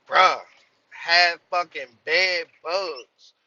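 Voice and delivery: loud, slow, disgusted drag on every word; exasperated outburst. Real, not acted. Trucker telling viewers about bed bugs, loud disgusted outburst. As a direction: let the outrage spill out loud and slow.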